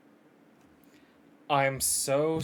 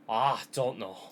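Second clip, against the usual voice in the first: ordinary talk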